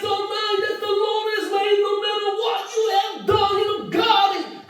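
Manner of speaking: high, slow, sung-out revival preaching; English preaching. Retold or imitated. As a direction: preach to thirty thousand in English. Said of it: "꿈에서 설교를 하는데". (높고 느린 영어 설교 흉내)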